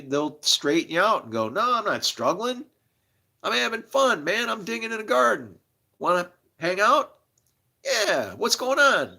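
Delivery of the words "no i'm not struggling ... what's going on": cheerful reply. Pretend. Imagined happy gardener replying.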